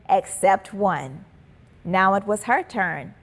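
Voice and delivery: plain reading voice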